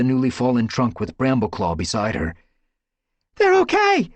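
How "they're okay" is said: high, loud relieved yowl. A narrator's reading. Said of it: "she yowled in relief".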